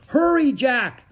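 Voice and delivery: high, loud urging; pressing high shout; urgent cry. A narrator's reading. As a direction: yell to rush someone along. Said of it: "cried Annie".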